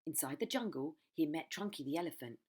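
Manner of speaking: plain reading voice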